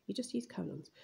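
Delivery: ordinary delivery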